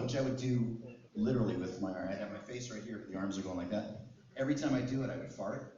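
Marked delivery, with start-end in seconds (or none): none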